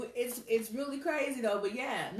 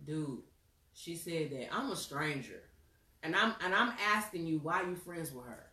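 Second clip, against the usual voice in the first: a hint of guy voice; 6 semitones lower, 3 dB quieter than usual. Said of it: "He goes".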